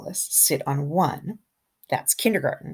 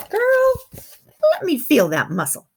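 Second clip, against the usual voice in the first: higher, louder mom voice; proud mom; 11 semitones higher, 6 dB louder than usual. Retold or imitated. Mom praising her daughter after shoveling snow. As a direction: call out like a proud mother. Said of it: "my mom says".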